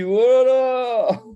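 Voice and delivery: «Voilà!» crié haut et fort; lance-le comme une évidence éclatante; exclamation. Real, not acted. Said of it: "Je me dis". (s'exclamer bien fort, aigu)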